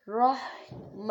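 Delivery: رحمہ with ح rubbed from the throat; throaty ha. Shown as a demonstration. Teacher demonstrating a throaty ح to the class. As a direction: scrape the ح in رحمہ from the throat, slowly. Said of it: "ہا کی آواز نکالنی ہے".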